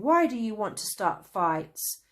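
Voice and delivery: loud scolding. A narrator's reading. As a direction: scold the tree. Traveller scolding tree.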